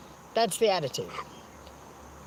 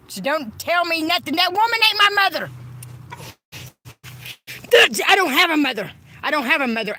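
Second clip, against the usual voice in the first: much louder, higher yelling, rushing at first; furious shout; 7 semitones higher, 6 dB louder than usual. Real, not acted. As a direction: shout down anyone calling her his mother. Furious denial of his mother.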